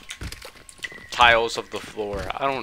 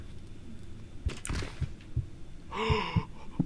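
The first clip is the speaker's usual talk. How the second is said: frightened sharp intake of breath; sharp gasp; 7 semitones higher, 13 dB quieter than usual. Real, not acted. Monster turns on him.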